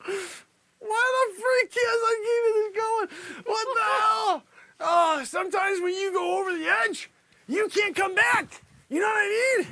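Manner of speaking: high helpless outburst; breaking character. Real, not acted. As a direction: shout it, losing control with laughter. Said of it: "started to break character".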